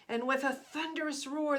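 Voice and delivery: ordinary delivery, nothing marked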